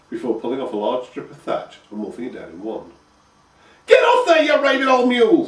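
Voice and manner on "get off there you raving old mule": put-on shrill high shriek of an old crone, much higher and louder; screeching old crone. A narrator's reading. Old crone screeching at a horse from her window. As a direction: screech it at the horse like a furious hag. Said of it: "screeched the old crone".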